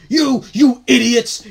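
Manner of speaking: high, loud, drawn-out insult; angry police officer. Acted. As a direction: burst out as the furious cop. Acts out the angry officer's thoughts.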